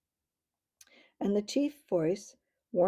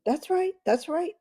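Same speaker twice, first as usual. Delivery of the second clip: much higher, loud, cheerful agreement; cheerful chorus; 11 semitones higher, 5 dB louder than usual. A narrator's reading. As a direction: chirp it out gladly. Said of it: "said all the voices cheerfully".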